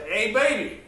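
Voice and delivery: high, loud holler to get attention; calling out. Retold or imitated. Neighbor calling to a baby in the road, loud high holler.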